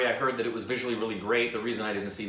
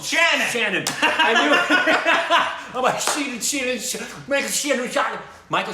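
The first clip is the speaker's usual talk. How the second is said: real eureka cry, much higher and louder, laughing; excited shout; 13 semitones higher, 9 dB louder than usual. Real, not acted. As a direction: blurt the name out in triumph, laughing. Finally recalls the actor's name.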